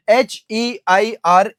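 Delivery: slow, letter by letter: एच ई आई आर; slow spelling. Shown as a demonstration. Teacher spelling heir slowly for the class. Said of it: "इस शब्द का बहुत खास ध्यान रखना है".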